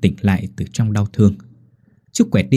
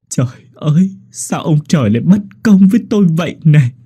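higher, louder sobbing cry to the heavens; wailing lament; 7 semitones higher, 5 dB louder than usual. Acted. Widower crying to heaven at the grave, wailing. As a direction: rail at heaven through sobs. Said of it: "rồi khóc".

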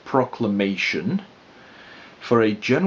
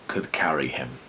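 hushed whisper at the end; 8 semitones lower, 6 dB quieter than usual. A narrator's reading. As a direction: let it fade to a breath. Hare runs away, fading.

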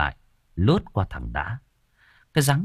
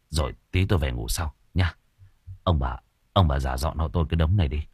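put-on sullen drunk son; 4 semitones lower, faster than usual. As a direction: brush them off wearily. Drunk son brushes mother off.

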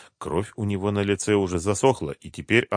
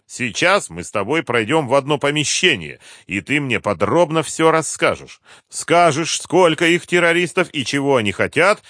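loud, raised, forceful voice; commanding colonel; 8 semitones higher, 6 dB louder than usual. A narrator's reading. Colonel tells captive he will tell all, commanding. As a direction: lay down what he will do.

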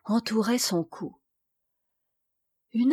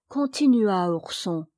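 ordinary delivery

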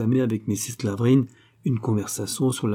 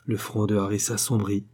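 ordinary delivery, nothing marked

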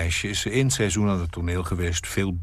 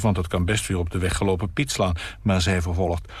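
usual speaking voice

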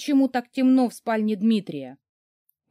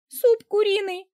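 much higher, tight squeaky cry; squeak; 12 semitones higher, 4 dB louder than usual. A narrator's reading. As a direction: squeak it out in panic. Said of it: "пропищала я".

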